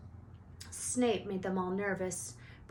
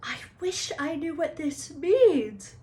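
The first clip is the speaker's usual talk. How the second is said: higher, louder, slow angry outburst; Harry rubbing his scar, frustrated angry outburst; burst out angrily; 8 semitones higher, 9 dB louder than usual. A narrator's reading. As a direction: blurt it out in frustration. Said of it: "he burst out angrily".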